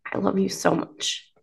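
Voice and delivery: soft and hushed, really moved; tender, emotional. Real, not acted. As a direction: say it quietly, close to tears. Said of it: "I'm getting emotional here".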